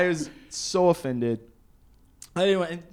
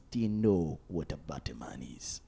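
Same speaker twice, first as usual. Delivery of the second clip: lower, put-on Jamaican voice; mock Jamaican accent; 12 semitones lower, 6 dB quieter than usual. Jamaican host quizzing tourists in a low mock Jamaican accent. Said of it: "he goes".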